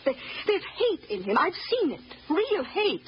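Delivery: high, urgent warning; vehement insistence. Acted. Housekeeper warning Gerald's mother, urgent and vehement.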